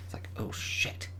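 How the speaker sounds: hushed whisper. Real, not acted. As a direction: whisper it under your breath. Whispered reaction.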